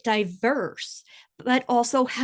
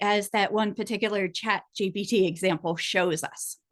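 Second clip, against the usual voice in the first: ordinary delivery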